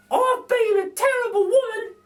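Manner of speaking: a cheeky cockney burglar lady; Burglar Betty. A narrator's reading. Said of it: "says Burglar Betty".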